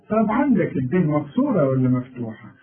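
ordinary delivery

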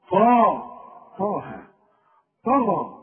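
طه loud, with a thick ط; طا and طه pressed heavy; emphatic ta. Shown as a demonstration. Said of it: "ما تقولش طه".

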